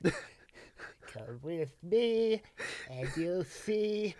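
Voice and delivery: dreamy Trump crooning wonder; whimsical Trump. Pretend. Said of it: "Is that whimsically Trumpy enough for you?".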